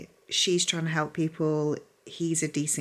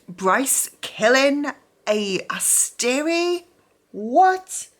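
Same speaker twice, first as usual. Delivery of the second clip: slow, high, loud stunned question; shocked disbelief; 8 semitones higher, 6 dB louder, much slower than usual. Real, not acted. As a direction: gasp out the twist in shock. Shocked at plot twist.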